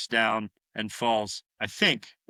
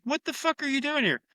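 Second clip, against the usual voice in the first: much higher, put-on voice of the shocked uncle; uncle's outburst; 13 semitones higher than usual. Uncle catching the kid in his closet, high startled demand. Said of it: "He's like".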